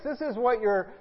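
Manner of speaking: ordinary talk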